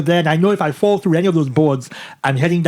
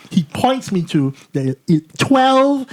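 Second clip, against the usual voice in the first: ordinary delivery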